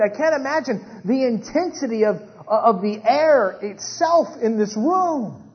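fervent, high-pitched pulpit storytelling; impassioned preaching. Real, not acted. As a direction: preach the tense scene with rising intensity. Preacher builds the scene with intensity.

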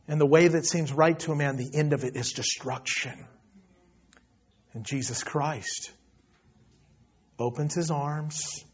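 soft, low, solemn appeal; hushed preaching. Real, not acted. Preacher pleads with congregation to come back and repent, hushed. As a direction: let the voice sink low and quiet, with long pauses.

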